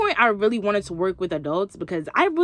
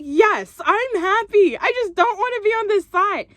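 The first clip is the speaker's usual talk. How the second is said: higher and louder, fed up; retold snap at a nosy coworker; exasperated insistence; 12 semitones higher, 4 dB louder than usual. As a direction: insist she's fine, losing patience. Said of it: "And I'm like".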